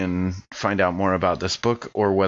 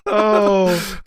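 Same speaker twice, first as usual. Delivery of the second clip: high loud laughing fit; cracking up at the joke; laughing hard; burst of laughter; 4 dB louder than usual. Real, not acted.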